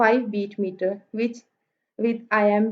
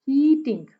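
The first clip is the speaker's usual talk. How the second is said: Heating with long, strong ही and light टिंग; trochaic stress. Shown as a demonstration. Teacher gives trochee example, first syllable of heating stressed. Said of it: "पहला stress है".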